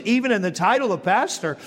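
ordinary delivery

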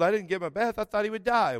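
normal talking voice

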